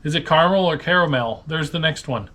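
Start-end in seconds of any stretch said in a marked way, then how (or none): 0.3-1.4 "caramel" first as CAR-mul, then as CARE-uh-mel; two caramel pronunciations. Shown as a demonstration. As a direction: contrast two-syllable and three-syllable "caramel". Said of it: "How do you say caramel?".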